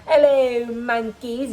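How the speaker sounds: a mocking hint of a gloating alien tyrant; playful mock villain greeting; Cooler sneer. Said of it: "you know that thing that he does".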